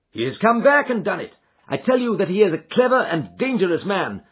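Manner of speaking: urgent, alarmed Holmes. Acted. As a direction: bark out the discovery. Holmes warns of danger.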